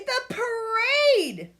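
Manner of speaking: high, slow drawn-out yell; desperate yell at Grandma. Acted. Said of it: "yelled Billy".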